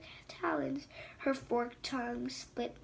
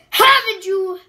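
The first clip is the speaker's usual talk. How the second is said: snarled; 4 semitones higher, 13 dB louder than usual. Acted. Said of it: "snarled Hiviter".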